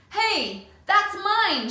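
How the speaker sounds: loud, high protest; shout. Acted. Boy on the floor shouting at the bully. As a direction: call out to stop the thief. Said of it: "shouts Schubert from the floor".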